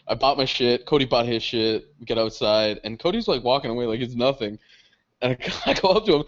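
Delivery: ordinary talk, nothing marked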